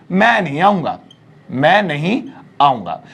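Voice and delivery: ordinary delivery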